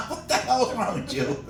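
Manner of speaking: ordinary talk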